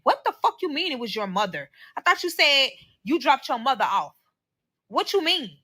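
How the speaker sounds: retold indignant questioning; indignant confrontation. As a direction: confront him over his slip-up. Retold confrontation with her partner.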